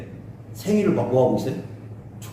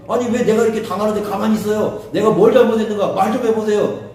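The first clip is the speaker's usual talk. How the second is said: high, raised-voice complaint to God; acted protest; 5 semitones higher than usual. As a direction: demand an answer, aggrieved. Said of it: "하나님을 향해서 막 항의를 하잖아". (높은 목소리로 억울하게 항의하듯)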